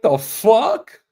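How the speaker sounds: high-pitched incredulous reaction; baffled outburst. Retold or imitated. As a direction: blurt it out high, in disbelief. Retold reaction of baffled teenager.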